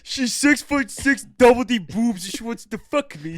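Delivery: high, loud mock ghost woman. Friend voicing the imaginary ghost woman, high and loud. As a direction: squeal it as the ghost bitch. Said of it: "That's what the ghost bitch said".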